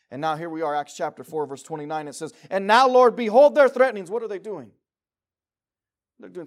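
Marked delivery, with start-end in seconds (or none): 2.5-4.1 loud, fervent reading of the prayer; ringing scripture reading. Acted. Preacher reading the apostles' prayer to the church, loud and fervent. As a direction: proclaim the verse with force.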